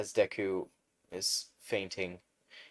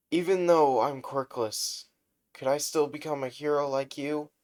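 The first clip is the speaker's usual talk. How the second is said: higher, louder hopeful plea; earnest hopeful question; 8 semitones higher, 6 dB louder than usual. Acted. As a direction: ask from the heart. Deku asking his dream question.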